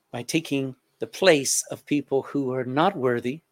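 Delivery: earnest pastoral prayer, swelling and fading. Acted. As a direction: pray aloud over the bowed congregation. Pastor leads closing prayer.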